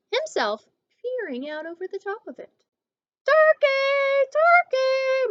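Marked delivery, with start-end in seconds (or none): 3.2-5.3 high, loud sugary call of the name; sugar-sweet singsong call; sweetest voice. A narrator's reading. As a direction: sweetly coax him out from the nest. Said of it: "called in his sweetest voice".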